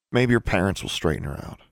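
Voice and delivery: ordinary delivery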